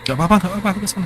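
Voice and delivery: the worried man insisting he'll come; worried boyfriend voice. Retold or imitated.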